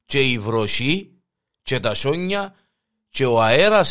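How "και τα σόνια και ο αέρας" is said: ordinary talk, nothing marked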